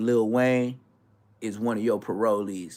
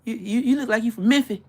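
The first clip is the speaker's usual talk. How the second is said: mock local "Mimfih", higher and louder each time; imitating Memphis locals; 10 semitones higher, 6 dB louder than usual. Mimicking how Memphis people ask where he's from. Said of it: "That's how they say it".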